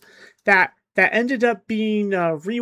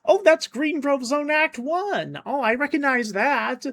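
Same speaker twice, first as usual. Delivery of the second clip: higher-pitched mock burst of recognition; excited recognition; 7 semitones higher than usual. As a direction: re-enact spotting something familiar on screen. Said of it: "like frickin' Leonardo DiCaprio pointing at the screen".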